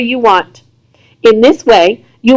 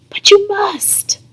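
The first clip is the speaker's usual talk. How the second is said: breathy whisper, slow and urgent; whispered plea; 10 semitones higher, 6 dB quieter, slower than usual. A narrator's reading. Wife urging her husband to go, in a slow whisper. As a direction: hush it out like a secret plea.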